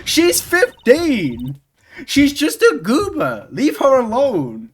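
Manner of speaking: mock protective outrage. Defending Trucy in mock outrage.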